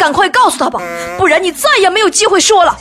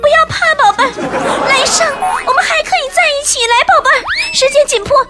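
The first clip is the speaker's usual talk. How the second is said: much higher, sweet and coaxing; 9 semitones higher, faster than usual. Acted. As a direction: coax a scared lover, cutesy. Bun comforts, asks for kiss. (高声撒娇哄人)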